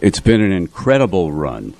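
ordinary talk, nothing marked

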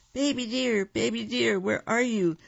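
high, searching calls; crying out. A narrator's reading. Animals search the forest for Baby Deer, calling out high. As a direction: call out high for someone lost. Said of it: "other animals were crying".

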